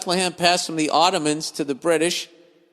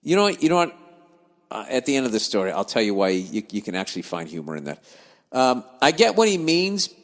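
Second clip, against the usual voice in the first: ordinary talk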